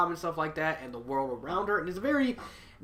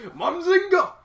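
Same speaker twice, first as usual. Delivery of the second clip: loud, much higher-pitched joking yell; catchphrase shout; 8 dB louder than usual. Acted. Reviewer joking about Big Bang Theory, shouting. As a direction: belt it out as a punchline.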